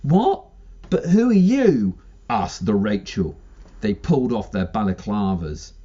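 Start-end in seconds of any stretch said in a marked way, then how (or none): 0.0-1.9 surprised. Acted. Rachel voiced in story.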